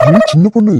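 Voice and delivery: loud, high shout of her name; sharp call. Acted. Husband calls wife sharply.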